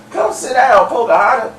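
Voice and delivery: high-pitched mock catcall; hollering at her. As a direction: call her over in a high, put-on voice. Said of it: "was hollering at her".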